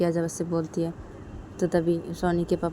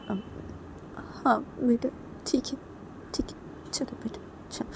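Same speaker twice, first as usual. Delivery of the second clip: very soft, fading into a whisper; weak assent; 6 semitones higher, 6 dB quieter, slower than usual. Acted. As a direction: agree faintly, worn out by tears. Weepy mother agrees to go. (बुझी हुई, फुसफुसाती हामी)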